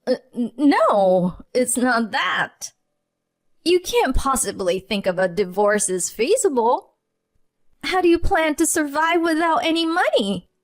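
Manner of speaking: falter, then grab at control; mother-in-law stammering, trying to keep composure; flustered stammer. Acted. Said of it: "her voice quivering".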